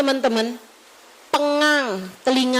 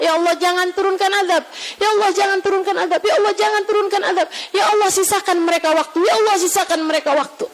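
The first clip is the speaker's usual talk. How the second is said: rapid, repeated plea in a raised pitch; pleading prayer; 4 semitones higher, much faster than usual. Acted. Preacher voices the Prophet begging God for his people, rapid plea. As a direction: plead urgently, one appeal straight after another. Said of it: "Nabi Muhammad berusaha".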